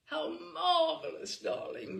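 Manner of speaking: high, loud gush on marvelous; hammed-up society lady cooing darling; gushing society hostess. A narrator's reading. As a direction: swoon it like a grand hostess. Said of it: "Missus D says".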